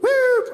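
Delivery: high loud woo of a police siren; police siren whoop; siren imitation.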